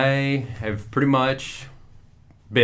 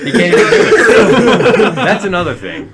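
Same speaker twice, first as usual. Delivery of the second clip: high, loud laughing tease; 10 semitones higher, 11 dB louder than usual. Real, not acted. Host laughs at friend who fumbles the county name, laughing. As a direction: crack up while teasing him.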